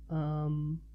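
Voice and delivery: soft, hesitant um; a small shy hesitation; quiet um. Acted. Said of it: "she said quietly".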